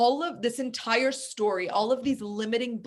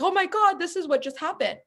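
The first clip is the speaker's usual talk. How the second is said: much higher, breathless with news; excited blurt; 8 semitones higher than usual. Retold or imitated. Kids running in to tell the family, excited and higher. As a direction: burst in with big news.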